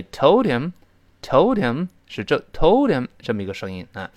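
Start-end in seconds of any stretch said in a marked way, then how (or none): 0.0-3.1 "told him" linked as "tol-dim", slow and raised; h dropped, linked. Shown as a demonstration. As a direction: run "told him" together without the h. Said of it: "这个的就把h打穿了". (told him连读，h被击穿)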